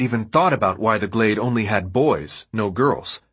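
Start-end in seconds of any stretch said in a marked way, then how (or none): none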